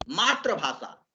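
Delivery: मातृभाषा with "tra" for "tri", as maatra-bhasha; mispronounced मातृभाषा. Shown as a demonstration. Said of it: "मातृभाषा भी कभी-कभी पढ़ देते हैं". (मातृभाषा को गलती से "मात्र-भाषा" पढ़ें)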